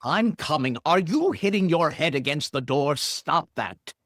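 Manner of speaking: calling through door. Acted.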